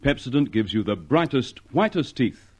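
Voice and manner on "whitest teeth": normal talking voice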